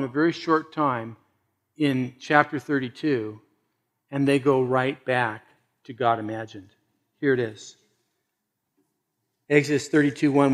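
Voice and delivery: usual speaking voice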